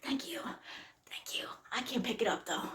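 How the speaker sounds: very quiet, near-whispered thank-you; tiny, hushed voice; faint thanks. Retold or imitated. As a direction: barely get the words out, holding everything in. Acted reply to people throwing change.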